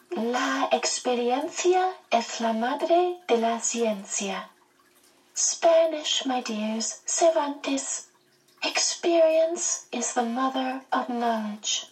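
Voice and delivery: a quaint being quoting Cervantes in Spanish; Mrs Who voice. A narrator's reading. Missus Who quotes Cervantes.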